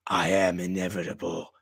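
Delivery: low, soft menacing Thanos line; lightly voiced villain boast; Thanos quote. Quoting Thanos's boast to Tony, low and quiet. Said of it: "He goes".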